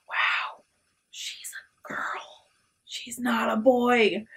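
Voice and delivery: breathless whispered realization; awed whisper. Retold or imitated. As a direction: whisper it like a shocked secret thought. Voicing the boys' stunned thoughts.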